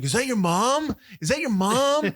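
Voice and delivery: high, loud, disbelieving; stunned Mike. Retold or imitated. Friend mimics Mike shocked at the naked mother, high and loud. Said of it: "he's like".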